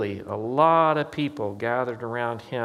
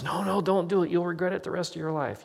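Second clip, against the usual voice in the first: quoted relatives pleading; 7 semitones higher, faster than usual. Retold or imitated. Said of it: "the other half of me says".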